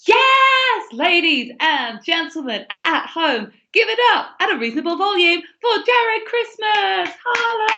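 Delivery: host hype. Real, not acted. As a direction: whip up the online crowd. Host announces comedian.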